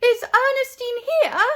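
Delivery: high loud anxious call. A narrator's reading. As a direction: call out across the room for her. Parents calling out searching.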